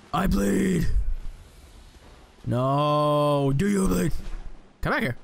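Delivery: hammed-up Batman snarl. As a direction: play the brooding hero mid-fight. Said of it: "talk in the Batman voice".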